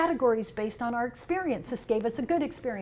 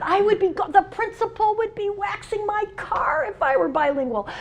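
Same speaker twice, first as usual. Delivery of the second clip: much higher, louder mock outrage at mom; mock indignant; 10 semitones higher, 5 dB louder than usual. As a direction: complain theatrically, voice pitched way up. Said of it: "and I said".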